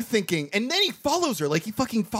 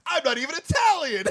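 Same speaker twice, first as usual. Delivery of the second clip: high, hammed-up dramatic confession; mock reveal; 10 semitones higher than usual. Movie villain confessing his secret at the end, mock reveal. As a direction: blurt it high, playing it for laughs. Acting out imagined film reveal.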